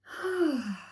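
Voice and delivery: long falling exhale on an open "ah"; audible sigh. Shown as a demonstration. Teacher leading a couple of breaths, audible sigh. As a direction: let the breath out in a voiced sigh.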